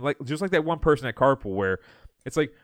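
ordinary talk

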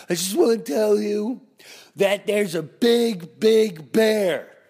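loud, high smoker-neighbor impression, drawn out; smoker's voice. Neighbor warns family about a bear, smoker's voice. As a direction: belt it out as the freaked neighbor. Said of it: "She had that smoker's deep voice".

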